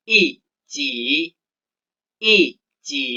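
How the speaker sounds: "i, ji" with yi in a falling fourth tone; Mandarin tone change. Shown as a demonstration. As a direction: model "i, ji" with a sharp falling first syllable. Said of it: "Kita baca bersama-sama".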